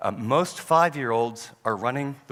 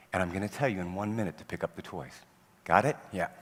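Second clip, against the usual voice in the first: calm firm parent; 6 semitones lower, 5 dB quieter than usual. Retold or imitated. Parent calmly warning his child about leaving, low and gentle. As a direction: lower and softer, patient and steady.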